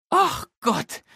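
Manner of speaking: high, loud exclamation of dismay; mother struck by dismay; dismayed Mama. A narrator's reading. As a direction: she just remembered the school cone. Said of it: "sagt Mama".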